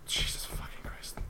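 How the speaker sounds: breathless frightened whisper. Real, not acted. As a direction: hush it, barely breathed. Creeping through scary level.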